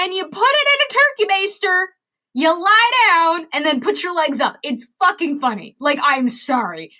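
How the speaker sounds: high and loud, cracking up at the absurdity; hammed-up glee winding down to a mock apology; dick answer. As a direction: play it as the crude joke answer, over the top. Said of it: "The dick answer is".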